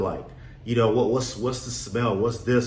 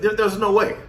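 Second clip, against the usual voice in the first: fired-up conviction; 10 semitones higher, 5 dB louder than usual. Retold or imitated. Retold inner self-belief.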